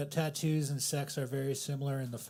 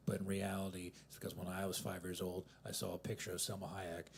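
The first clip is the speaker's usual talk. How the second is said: ordinary talk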